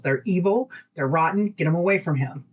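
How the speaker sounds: ordinary talk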